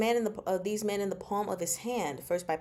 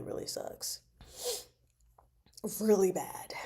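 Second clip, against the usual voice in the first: hushed whisper of sympathy; whispered dismay; 4 dB quieter than usual. Real, not acted. Reacting to the grim history, a whispered sympathy. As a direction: breathe it out under your breath.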